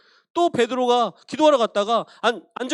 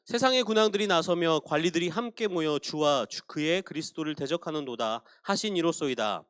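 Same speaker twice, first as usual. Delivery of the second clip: measured, solemn reading of the prayer; scripture reading; 4 semitones lower than usual. Acted. Pastor reading Acts prayer to the congregation, solemn reading.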